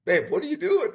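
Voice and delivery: high-pitched, exasperated retold question; alarmed disbelief. Husband catching wife bidding again, acted dismay.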